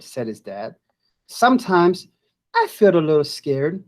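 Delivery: lively storybook voice with big rises; reading to children. Acted. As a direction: read it like a picture book to kids. Teacher reads picture book aloud.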